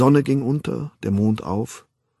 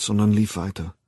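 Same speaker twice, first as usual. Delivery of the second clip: usual speaking voice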